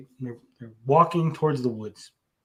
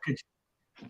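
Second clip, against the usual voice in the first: whispered; 10 dB quieter than usual. Real, not acted. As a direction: whisper "good joke" without voice. Repeats punchline praise, then whispers.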